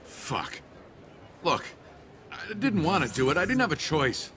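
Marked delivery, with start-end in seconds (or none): 2.3-4.3 high-pitched defensive plea; frantic self-justifying; desperate pleading. Acted. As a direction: plead for your life. Cornered man pleads for his life.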